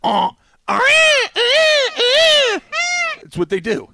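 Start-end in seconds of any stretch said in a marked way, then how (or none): 0.0-2.6 piercing high shrieking bird call, far above the voice; loud imitated peacock cry; peacock honk scream. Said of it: "That's your best impression".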